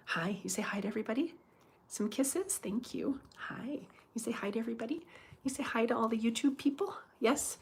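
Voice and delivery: soft, coaxing little voice; cooing to pet. Real, not acted. Owner coaxes her pet to greet YouTube viewers, cooing softly.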